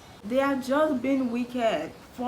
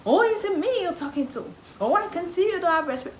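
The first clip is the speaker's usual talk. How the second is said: mocking relative; 10 semitones higher, 3 dB louder than usual. Relative mocking after talk-back.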